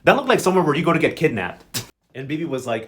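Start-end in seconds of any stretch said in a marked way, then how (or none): none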